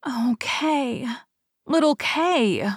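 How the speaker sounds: slow, high longing sigh. Acted. Gerda sighing for her lost friend Kay, high and slow. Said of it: "sighed Gerda".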